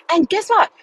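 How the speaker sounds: high, bright teasing question; excited tease. Real, not acted. Telling followers about the house, high excited tease. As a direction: lean in and tease the reveal.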